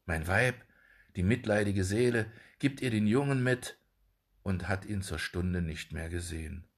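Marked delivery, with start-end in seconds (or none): none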